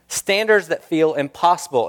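normal talking voice

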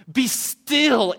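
high, loud shouted decree; booming command. Retold or imitated. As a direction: roar it out over the nations. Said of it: "One day God will come and say".

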